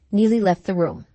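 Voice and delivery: ordinary delivery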